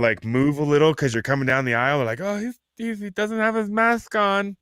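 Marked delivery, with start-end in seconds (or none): 2.2-4.6 very high, dragged-out whiny voice; mock scandalized shopper. Mimicking shoppers spotting a bare face, high mock horror. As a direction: whine it like an appalled onlooker. Voicing shoppers shocked at no mask.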